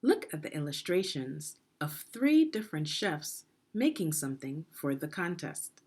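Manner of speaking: ordinary delivery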